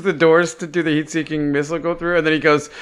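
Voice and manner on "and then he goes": ordinary talk, nothing marked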